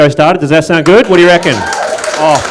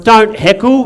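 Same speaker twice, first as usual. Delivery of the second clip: ordinary talk, nothing marked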